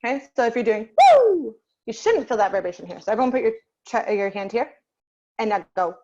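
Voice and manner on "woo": high, loud hoot; high-pitched whoop; head-voice woo. Shown as a demonstration. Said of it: "head voice is our higher voice".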